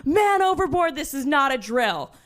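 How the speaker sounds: man overboard alert called high and urgent; alarm call. Retold or imitated. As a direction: call the emergency out to the whole crew. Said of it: "they're like".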